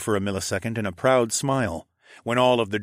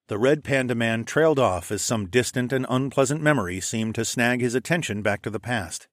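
ordinary delivery

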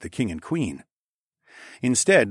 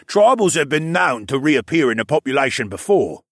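loud, higher-pitched arguing voice; insistent; 5 semitones higher, 6 dB louder than usual. A narrator's reading. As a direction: keep pressing the objection. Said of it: "Nawel insisted".

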